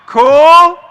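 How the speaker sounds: loud hype call. Real, not acted. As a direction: bellow it out to the back row. Checking in with the crowd.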